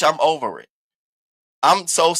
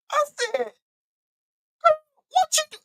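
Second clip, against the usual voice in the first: shrieking excitement. Real, not acted. Shrieking exclamation.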